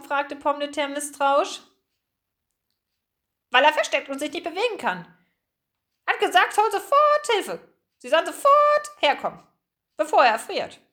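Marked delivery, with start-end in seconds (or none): 3.5-10.8 high, loud squawking bird voice; urgent seagull. Acted. Gull explains why Gruyère sent her, urgent squawking. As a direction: squawk the alarm with pitch way up.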